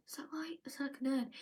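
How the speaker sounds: quick whispered aside. Real, not acted. Vlogger whispering a quick question to herself. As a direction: drop to a whisper for a side question.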